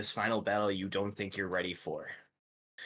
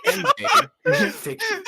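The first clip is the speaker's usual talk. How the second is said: laughing through words; 11 dB louder than usual. Real, not acted. GM laughing while wrapping up.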